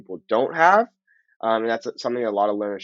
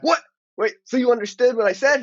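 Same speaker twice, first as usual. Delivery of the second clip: high, louder burst of amazed disbelief; astonished; 11 semitones higher, 5 dB louder than usual. Retold or imitated. Learner amazed a friend understood his Spanish, astonished. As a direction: act out stunned surprise, pitched way up.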